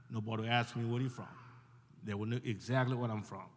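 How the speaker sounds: usual speaking voice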